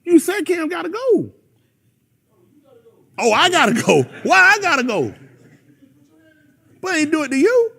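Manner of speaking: high, loud mock indignation; indignant protest. Preacher acting out being told to go, indignant. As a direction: protest as if wrongly sent away.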